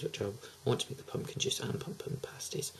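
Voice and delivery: soft unvoiced whisper, half to herself; whispered aside. Real, not acted. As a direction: let the wish slip out in a whisper. Whispered aside about wanting pasties.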